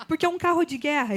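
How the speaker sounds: laughing, quick and bright as she talks; real laughter breaking through the words. Real, not acted. Guest laughing at forgetting her car's name, talking through a laugh.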